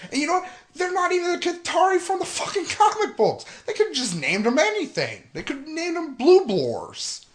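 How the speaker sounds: high, loud, incredulous rant; outraged rant. Real, not acted. Fan mocking the invented alien names. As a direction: go up high in disbelief.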